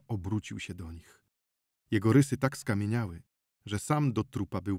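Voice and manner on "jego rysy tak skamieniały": usual speaking voice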